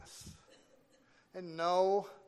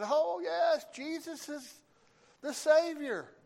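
mimicked eager agreement; 13 semitones higher, 4 dB louder, slower than usual. Mimics visitors' insistent agreement.

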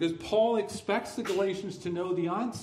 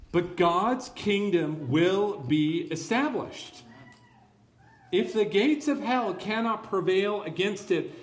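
defiant pulpit crescendo; preaching at full force; 3 semitones higher, 3 dB louder than usual. Real, not acted. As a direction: drive the promise home to the congregation, rising to a loud peak.

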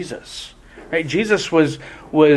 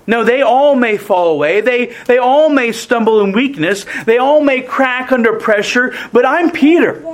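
boastful Peter; 6 semitones higher than usual. Retold or imitated. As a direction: swell up with pride as Peter. Peter voiced boasting he won't fall.

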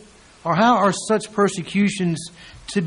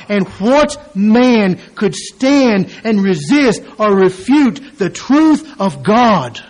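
loud, higher, slowed proclamation; preaching at full force; 4 semitones higher, 8 dB louder than usual. Acted. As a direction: thunder the promise to the congregation. Preaching Jesus' words.

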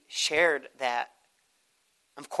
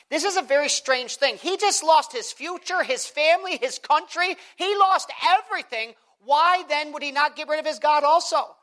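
higher and louder preaching; full-force preaching; 12 semitones higher, 6 dB louder than usual. Acted. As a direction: preach it up to the congregation.

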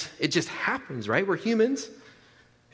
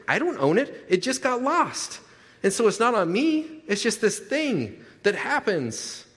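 higher, slow, hammed-up excuse making; mock excuse; 6 semitones higher than usual. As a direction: shrug off the blame in a put-on voice. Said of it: "we have this kind of way of saying".

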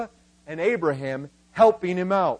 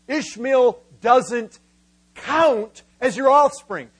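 "count" set off by a pause and hit hard; slow before "count", then punch "count"; stress on count; 3 semitones higher, 4 dB louder, slower than usual. Acted. Preacher voicing God to Abraham, stressing "count". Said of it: "I emphasize that word count".

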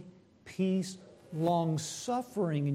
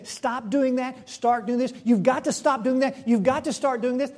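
mock scolding, higher-pitched orders; accusing; 6 semitones higher, 5 dB louder than usual. Preacher mimics believers blaming each other, accusing. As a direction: hammer out mock moralizing demands. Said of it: "We accuse them. We blame them".

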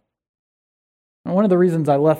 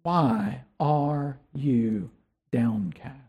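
softer, slower, turned inward; quiet inward question; 6 semitones lower, 7 dB quieter, much slower than usual. Retold or imitated. Pastor urging listeners to question their soul, soft and slow. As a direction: ask your own soul gently and quietly. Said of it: "Can you find out".

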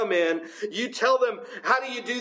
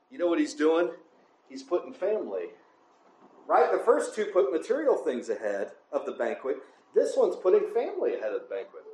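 usual speaking voice

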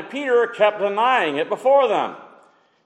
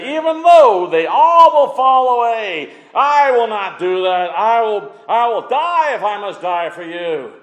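bold vow; 5 semitones higher, 5 dB louder than usual. Acted. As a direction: proclaim a bold vow with raised pitch. Acting Peter's bold vow to Jesus.

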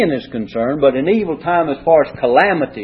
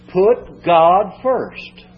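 slow emphatic punchline; much slower than usual. Real, not acted. Preacher drives home bottom line.

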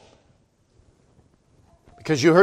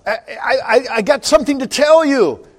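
put-on high, eager child calling out to mom; a child bursting in with news, stammering; 7 semitones higher than usual. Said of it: "you came running in and you said".

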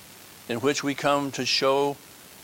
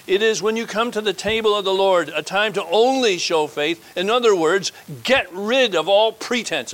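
loud, high pulpit exhortation; forceful preaching; 5 semitones higher, 5 dB louder than usual. Acted. Preacher urging the congregation at the Lord's table, forceful sermon. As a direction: drive it home like a preacher at full cry.

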